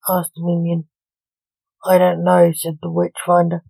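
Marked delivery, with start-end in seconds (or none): 1.8-2.5 in shock. A narrator's reading. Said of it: "in shock".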